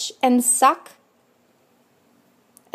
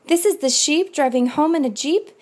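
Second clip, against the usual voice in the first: plain reading voice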